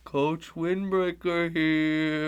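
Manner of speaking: slow, lightly spooky ghost voice; drawn-out hint of a ghost coach; Coach Windbreaker. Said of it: "a special, uh, ghostly voice from the stands".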